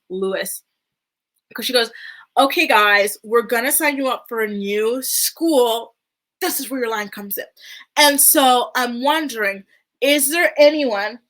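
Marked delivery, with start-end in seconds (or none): 2.4-11.2 slow, loud, higher singled-out words; hammed-up stagey mom addressing kids; mock coaching mom. Mother prompting her kids on camera, exaggerated slow coaching.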